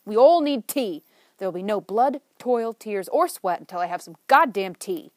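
loud, insistent and commanding; booming demand. Acted. Chuck demands tea from her friends, loud commanding boom. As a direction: declare it like a rallying speech. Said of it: "in her deep, booming voice".

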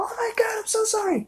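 high, hushed falsetto apology; imitating a flustered girl whispering sorry. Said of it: "I think she was like".

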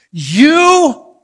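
high, loud drawn-out shriek; yell at lungs' top. Retold or imitated. Wife shrieking at her husband after the pinch, top-of-lungs yell. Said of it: "yells at the top of her lungs".

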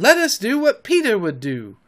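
high-pitched eager resolve; excited boyish call to action; rallying cry. A narrator's reading. Boys rallying against the wolves.

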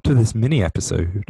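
airline pilot announcing to the cabin; pilot announcement. Acted. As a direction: announce it like a pilot over the intercom. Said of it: "started off as an air pilot announcing".

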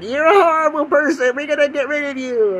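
high, loud hammed-up denunciation; mock outrage. Republicans ousting their House leader, mock outrage. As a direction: declaim it like an indignant accuser. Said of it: "the Republicans are like".

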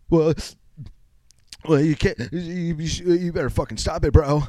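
high, stammering tearful outburst; mock about to cry. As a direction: try to yell while breaking into tears. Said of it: "I'll, like, start crying".

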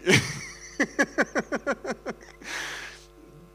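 long high airy laughter; helpless fit of laughing; wheezing giggle; long breathy laugh. Real, not acted. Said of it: "I can't stop laughing".

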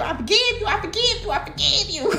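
sing-song high I forgive you; a mocking high voice saying I forgive you; mock high forgiveness. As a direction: tease it up high, half laughing.

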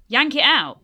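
loud, high, sharp order; snapped. Acted. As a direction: bark it at Mercury to act now. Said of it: "she snapped".

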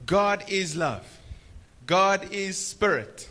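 slow, loud and high "God is love"; slow preaching emphasis. Real, not acted. Preacher recapping for the congregation: slow loud proclamation. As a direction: proclaim each phrase slowly. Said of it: "summarizing in preaching".

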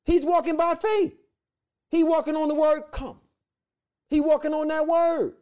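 preacher soaring at the peak; ecstatic preaching. Real, not acted. Preacher's climax on Peter walking.

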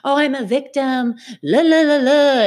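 loud, high mock wailing; mock victim whine. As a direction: play the victim, over the top. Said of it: "don't have to do it in a way where".

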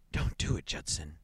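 a frightened, breathless "Don't do it" with the stress on "do"; gasping plea. A narrator's reading. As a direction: gasp it out, pleading and scared. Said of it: "she gasped".

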